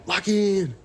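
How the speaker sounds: high, loud pump-up yell; "Lock in!" shouted high and loud; self-hype shout. Real, not acted. Psyching himself up.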